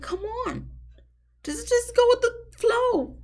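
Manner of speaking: very high-pitched, squeaky exasperation; exasperated plea. Acted. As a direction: plead in a strained falsetto.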